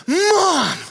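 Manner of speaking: put-on high, loud child's shout; a little girl calling across a crowded store; child yelling for mom. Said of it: "she heard this little girl's voice go".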